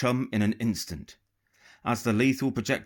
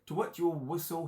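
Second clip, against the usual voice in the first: ordinary delivery, nothing marked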